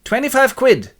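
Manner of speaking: high, loud price demand; demanding wife. Acted. Wife bargains with stranger, high and demanding.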